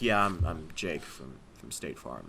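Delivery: very low, soft, slow deadpan; Jake from State Farm. Acted. Costumed guest introducing himself at Halloween, low character voice. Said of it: "that's how I talked the whole time".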